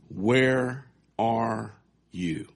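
drawn-out, very slow "Where are you?"; key question, spoken very slowly. Shown as a demonstration. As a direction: stretch each word of "Where are you?" and lean on "Where". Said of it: "Could you say that with me".

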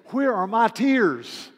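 loud, slow, high lament; crying out. Retold or imitated. Preacher asking himself aloud, anguished cry. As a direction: cry out to yourself, ashamed. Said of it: "I just look at that and I say".

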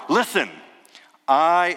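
"I" said high and loud, set apart; emphatic I. Shown as a demonstration. Preacher showing the emphatic I in Jesus' promise. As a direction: hit the word I hard after a pause. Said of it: "it's an emphatic I".

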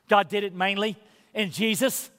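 usual speaking voice

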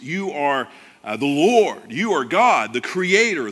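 ordinary talk

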